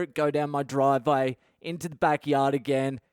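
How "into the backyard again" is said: ordinary talk, nothing marked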